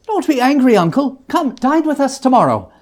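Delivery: high, loud, coaxing invitation; eager invitation. A narrator's reading. Nephew inviting his angry uncle to dinner, high and bright. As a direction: win him over with cheer.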